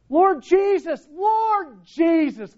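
high, ringing shout of praise; cry of celebration. Acted. Preacher voicing Stephen's dying cry to Jesus, celebratory shout. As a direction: cry it out jubilantly, drawing out the second call. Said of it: "It's the cry of celebration".

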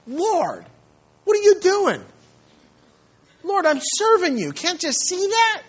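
high, loud, aggrieved cry to the Lord; complaining prayer. Retold or imitated. Preacher imitating a bitter missionary crying out to the Lord, high and loud. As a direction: plead like a wronged servant demanding an answer. Believer voiced complaining to God.

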